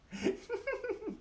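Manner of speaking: high, light laugh to make the body buzz; high airy giggle; vibrating laugh. Shown as a demonstration. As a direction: laugh softly so every cell vibrates. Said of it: "Feel that vibration".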